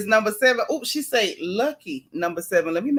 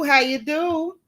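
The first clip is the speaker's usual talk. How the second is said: How you do sung higher and playful; playful sing-song; 5 semitones higher, slower than usual. Real, not acted. Host greeting a viewer again, higher and playful. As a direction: toss the greeting up high, teasing.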